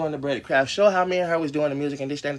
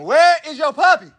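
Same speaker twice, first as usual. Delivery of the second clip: high, loud yell across the yard; calling out to the dogs; 14 semitones higher, 8 dB louder than usual. Real, not acted. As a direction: shout the question outside. Yelling to the dogs.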